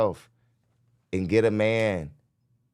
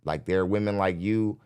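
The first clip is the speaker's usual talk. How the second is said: ordinary talk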